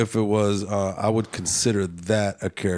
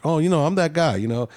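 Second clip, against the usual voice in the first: higher-pitched, casual self-selling act; fooling pretender; 8 semitones higher, 3 dB louder, faster than usual. A man playing a character to fool someone, mock self-selling. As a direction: put on an easy "that guy" front. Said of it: "I'm trying to fool you in a way".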